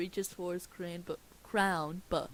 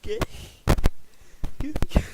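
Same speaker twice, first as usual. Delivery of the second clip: high, loud start cut off by giggles; breaking into laughter; 15 dB louder, much faster than usual. Real, not acted. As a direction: lose it mid-name. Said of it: "why I'm laughing".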